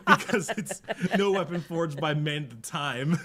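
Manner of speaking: talking through laughter; laughing while talking. Real, not acted. Still laughing at own joke.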